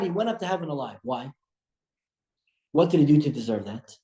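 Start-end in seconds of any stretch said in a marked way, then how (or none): none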